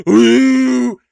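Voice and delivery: high, loud, held "ooh"; long swooping sound effect; ride launch whoop. Retold or imitated.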